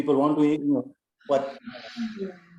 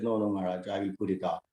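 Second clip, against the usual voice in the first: softer, lower, placating reply; retold backing down; 7 semitones lower, 6 dB quieter, faster than usual. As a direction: retreat meekly, voice dropped low. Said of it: "took a back step and I said".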